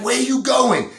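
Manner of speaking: loud, high-pitched calling after him; high shrill call. Acted. Escort calling after the client running out naked.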